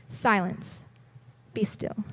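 a hint of quiet authority; quiet command. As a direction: say it hushed, with authority. Jesus calming the storm.

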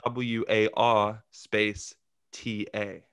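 W A R space T A spelled out slowly; the British way, letter by letter, slowly; slow spelled British water. Shown as a demonstration. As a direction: spell each letter with long gaps. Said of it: "Brits say it".

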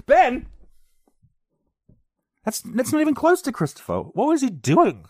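high, loud disbelief; can't believe he said Ben; incredulous outburst. Real, not acted. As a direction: cry out at the absurd mistake. Outraged at wrong name.

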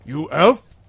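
loud troll question, rising high. Acted. Club-slapping troll to a stranger, loud rising question. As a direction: throw it out as a blunt, loud challenge. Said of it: "he starts like".

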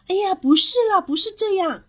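put-on high, whiny child's objection; exasperated protest. A narrator's reading. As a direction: protest at being misunderstood. Child protests being misunderstood. (着急地高声否认)

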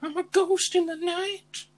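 high, slow ghostly wail; spooky ghost. Pretend. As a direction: moan it like a cartoon ghost. Playing a ghost after joke.

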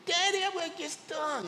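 put-on high panicky little girl voice; frightened child. Daughter afraid of the bee calling her father, panicky. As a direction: cry it out like a scared child. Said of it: "she's still screaming".

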